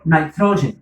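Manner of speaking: nitrogen with first syllable like "night"; alternative pronunciation. Shown as a demonstration. Teacher showing students the other accepted way, nitrogen said NIGH-tro-jen. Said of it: "you can pronounce it also".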